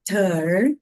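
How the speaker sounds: TER ending in a curled American R; rolled-back R. Shown as a demonstration. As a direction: hold the R in TER, American style. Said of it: "você enrola bem o R".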